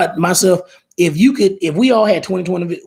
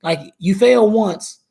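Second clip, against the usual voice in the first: lightly acted therapist, pitched higher; therapist's voice; 6 semitones higher than usual. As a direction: question a client in a higher, lightly put-on voice. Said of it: "the therapist may help you think about".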